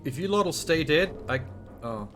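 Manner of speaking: normal talking voice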